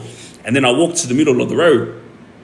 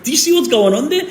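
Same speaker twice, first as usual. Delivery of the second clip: high, incredulous aside to wife; pitched-up, agitated question; retold alarm; 13 semitones higher, faster than usual. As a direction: imitate your own startled disbelief.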